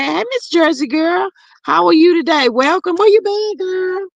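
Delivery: high, bright welcome; excited greeting. Real, not acted. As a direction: greet her with delight. Greeting a returning viewer.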